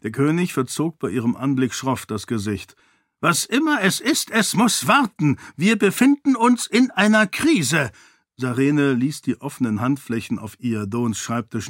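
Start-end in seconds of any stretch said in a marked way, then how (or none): 3.2-7.9 a much higher, curt, impatient royal voice; irritated king. A narrator's reading. The king waves Sarene off during a crisis, curt and impatient. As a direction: brush her off like a busy, annoyed king.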